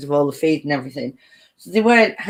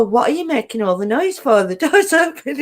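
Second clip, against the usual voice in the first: higher, cheeky cat voice with a laugh breaking in; the cats sassing the husband, high and teasing; cats answering back; 6 semitones higher than usual. Retold or imitated. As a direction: give the cats a sassy voice. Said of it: "and they'll go".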